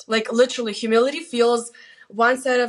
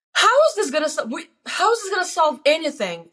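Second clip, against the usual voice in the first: indignant protest; 6 semitones higher than usual. Retold or imitated. Retold indignant protest to God.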